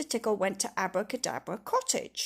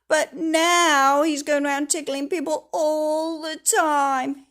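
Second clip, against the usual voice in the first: raised, loud complaint, slowing at the end; sighing Mister Happy; 5 semitones higher, 8 dB louder than usual. Acted. Mister Happy explains the tickling, raised and weary. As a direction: plead the trouble, dragging it out. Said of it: "he sighed".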